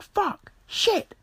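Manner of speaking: very high squeaked mock curses; uncontrollable swearing. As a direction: falsetto reenactment of helpless cursing. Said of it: "I just couldn't stop swearing".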